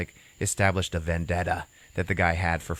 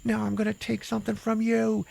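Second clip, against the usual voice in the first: very high, strained tearful threat; imitated crying. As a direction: threaten as if through tears. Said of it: "he's crying and everything".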